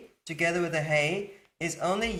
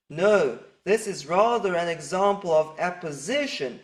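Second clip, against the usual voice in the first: ordinary talk, nothing marked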